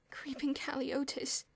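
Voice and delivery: slow breathless whisper; moaned. A narrator's reading. George whispering the disease name in dread. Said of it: "George moaned".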